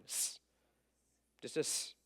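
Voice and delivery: a long unvoiced "S" hiss; just hiss "S" with no voice; hissed S sound. Shown as a demonstration. Teacher hissing "S" for the class. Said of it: "it's an S sound".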